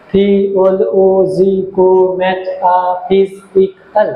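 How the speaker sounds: থিওলজিকো metaphysical drawn out very slowly, each syllable apart. Shown as a demonstration. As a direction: spell out থিওলজিকো metaphysical slowly so students can count syllables. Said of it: "এক, দুই, তিন". (ধীরে ধীরে প্রতিটি syllable আলাদা করে)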